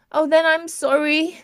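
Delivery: high, loud tearful outburst; bursting out on the edge of tears; nearly in tears. A narrator's reading. Sister blurts an apology to the injured patient, high and tearful. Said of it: "Sally burst out nearly in tears".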